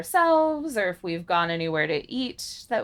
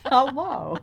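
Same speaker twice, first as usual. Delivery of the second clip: automated voice; 3 semitones higher than usual. Retold or imitated. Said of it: "an automated voice going".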